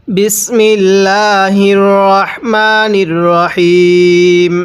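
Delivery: slow, drawn-out chanted bismillah; Quranic recitation. Acted. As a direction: intone it slowly like scripture. Opens Quran lesson with recitation.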